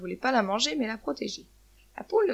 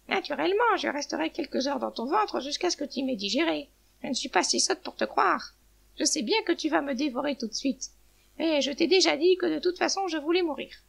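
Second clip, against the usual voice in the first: high-pitched, disbelieving retort; sarcastic hen; 4 semitones higher than usual. Acted. Hen refuses to believe the eagle, sarcastic. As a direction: scoff in a raised pitch.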